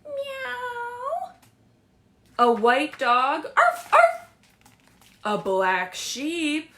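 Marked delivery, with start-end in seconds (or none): 0.0-1.3 squeaky mock meow; cat meow. Shown as a demonstration. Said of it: "What sound does the cat make?". 3.6-4.3 high, loud yappy arf; shrill playful puppy bark; dog bark. Shown as a demonstration. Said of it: "What sound does the cat make?".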